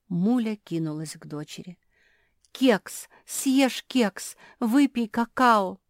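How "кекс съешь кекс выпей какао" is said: high, loud pleading bursts; frantic urging. A narrator's reading. Муля rushes to her daughter offering treats, frantic. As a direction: press food on her in desperation.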